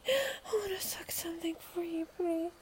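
teary pleading whisper. Real, not acted. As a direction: beg softly under your breath.

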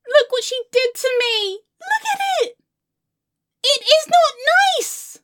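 high, tearful protest; anguished wail. Acted. Showing friend the ruined hair, anguished wail. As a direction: wail upward in horror.

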